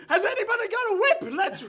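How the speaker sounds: high, fast, loud and eager; eager crowd voice. Acted. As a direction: ask it like a giddy rioter. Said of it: "they suddenly go".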